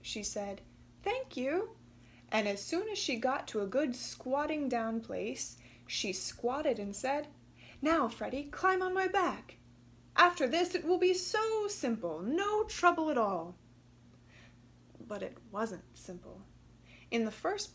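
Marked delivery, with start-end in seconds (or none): 1.0-1.8 pleased thanks. Acted. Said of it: "Katy was pleased". 7.8-13.6 high, upbeat kangaroo mother; confident instructions to her little boy; a bright, sure-of-herself Katy; cheerful mother. Acted. Katy tells her son.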